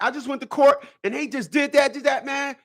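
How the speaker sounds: high-pitched mock complaint; complaining guys. Guys griping after court, high-pitched mock complaint. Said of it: "run around here and be like".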